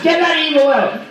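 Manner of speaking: high, urgent mock plea; mock exorcism. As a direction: cast it out in hammed-up desperation. Acts out frantic self-exorcism.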